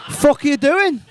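ordinary talk, nothing marked

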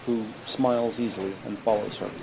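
normal talking voice

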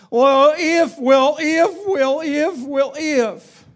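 hammed-up whiny well if; mock whining. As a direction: parody a complainer who keeps hedging. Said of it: "whiny, pitiful me song".

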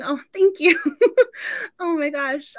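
high, tearful thank-you breaking into a laugh; teary thanks. Real, not acted. Thanking the host for kind words, close to real tears. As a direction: choke up with gratitude and laugh through it. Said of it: "I'm trying not to cry".

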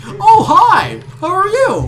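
very high, loud, over-bright greeting; specific greeting voice. Retold or imitated. Retelling how they greet random Walmart strangers, high loud greeting voice. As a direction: gush hello in a high, loud put-on voice. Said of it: "In that specific voice".

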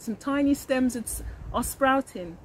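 plain reading voice

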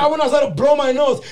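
high, loud pleading; exasperated protest. Retold or imitated.

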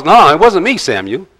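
a mock Saul shifting the blame, voice jumping up; defensive Saul. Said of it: "now Saul is".

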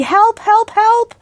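high, urgent repeated calls; loud cry for help. A narrator's reading. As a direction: call out loudly for rescue. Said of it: "then loudly".

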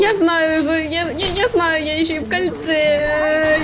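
high, stammering panic shout; panicked shout. Real, not acted. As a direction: blurt it out in panic. Panicked while being chased.

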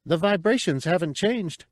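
high, eager cry of discovery; a small creature's delighted squeak; exulting high little voice; exulted. A narrator's reading. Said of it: "Cabe exulted".

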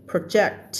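"project" stressed on the second syllable; stress on JECT. Shown as a demonstration. Teacher showing the verb, "project" stressed on JECT. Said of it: "มันจะมาลงตรงที่".

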